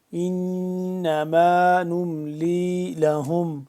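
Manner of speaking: formal Quranic recitation. Shown as a demonstration. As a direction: recite with full tajweed, drawn out. Recites verse slowly.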